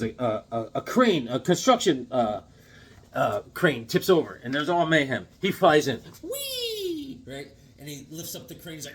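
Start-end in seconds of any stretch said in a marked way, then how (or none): 6.2-7.2 very high, sustained squealed whee; playful whee. Retold or imitated. Retelling the hero flying in, high playful whee. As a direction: imitate swooping through the air.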